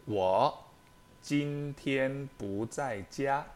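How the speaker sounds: slow, clear teacher read of 我今天不在家; slow model sentence. Shown as a demonstration. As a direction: model each syllable slowly for learners. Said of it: "第一个句子".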